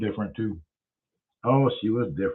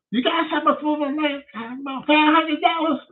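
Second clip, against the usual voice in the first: high squeaky voice; high mocking mimic. Narrator hams up his own scam pitch, high voice. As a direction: pitch way up as a mock. Narrator fakes a money request.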